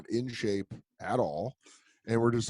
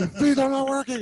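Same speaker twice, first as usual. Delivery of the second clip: higher, louder mock trainer scolding; imitating the trainer; 7 dB louder than usual. Retelling the trainer scolding the actor in the ring. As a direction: scold him like a tough coach. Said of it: "And he's like".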